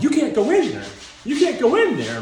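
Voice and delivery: frantic doctor. Retold or imitated. Doctor blocking Rocky at the hospital door, frantic shout. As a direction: shout to stop him at the door. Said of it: "and doctor's like".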